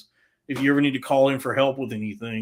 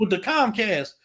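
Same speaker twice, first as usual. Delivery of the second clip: yelling mother; 8 semitones higher than usual. Retold or imitated. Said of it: "and then her going".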